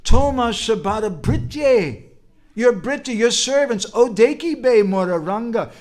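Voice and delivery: high, loud, full-force sermon voice; impassioned preaching. Real, not acted. Preacher expounding Mahaprabhu's promise to devotees, preaching fervently. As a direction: proclaim verse and translation fervently.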